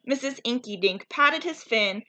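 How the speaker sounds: ordinary delivery, nothing marked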